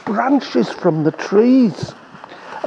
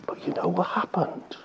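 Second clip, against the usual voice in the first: whisper; 13 dB quieter than usual. Real, not acted. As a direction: whisper it like a secret. Storyteller leans in, hushed.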